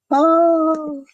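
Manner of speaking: high, bright little oh; delighted surprise. Real, not acted. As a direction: caught off guard and pleased. Sees her there in person.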